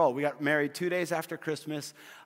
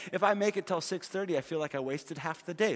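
normal talking voice

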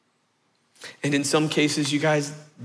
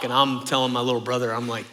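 ordinary talk, nothing marked

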